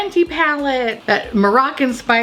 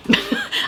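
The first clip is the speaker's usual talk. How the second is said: high laugh. Real, not acted. Laughing at brain-matter pans.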